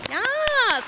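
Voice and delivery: high, drawn-out squeal of approval; excited, high-pitched cheer; delighted yes. Real, not acted. Delighted reaction to audience member.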